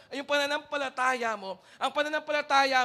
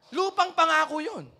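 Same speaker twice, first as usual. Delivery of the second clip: ordinary talk, nothing marked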